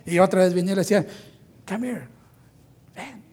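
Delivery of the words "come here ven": much softer whispered-style call; hushed call. Retold or imitated. Marta quietly calling Jesus away from teaching, hushed. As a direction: beckon him over under your breath.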